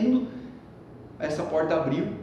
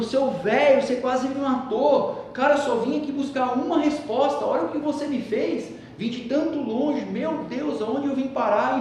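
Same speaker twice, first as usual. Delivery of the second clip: angry cursing; 9 semitones higher than usual. Retold or imitated. Half-drowned boy rails at the sage, high and furious. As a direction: chew out the old man, outraged. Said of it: "E ele xingando".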